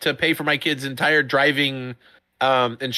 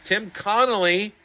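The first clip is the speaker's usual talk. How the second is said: slow, louder race-caller voice, pitch climbing high on the position; drawn-out announcer call; 6 semitones higher, 3 dB louder, slower than usual. Acted. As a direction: stretch the name out like a stadium announcer, then pop the position up high. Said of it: "the sound of my voice doing that".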